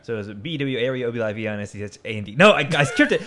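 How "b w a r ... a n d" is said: fast spelled-out letters; rapid-fire spelling. Shown as a demonstration. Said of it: "muscle memory of, like, saying it fast".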